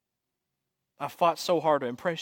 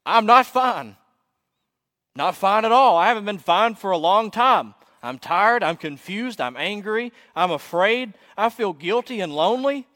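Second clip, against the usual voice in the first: loud, high-pitched confession to the whole room; acted yelled outburst; 6 semitones higher, 6 dB louder than usual. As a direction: yell it out, fed up with pretending. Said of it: "I yell out to everyone that can hear".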